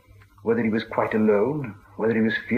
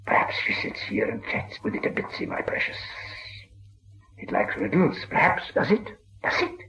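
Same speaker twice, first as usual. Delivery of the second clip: oily, hushed, overly polite whisper; wheedling creature whisper; 6 semitones higher, 4 dB quieter than usual. A narrator's reading. Gollum turns falsely polite, coaxing Bilbo toward riddles. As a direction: coax and flatter in a slimy whisper. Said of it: "became quite polite".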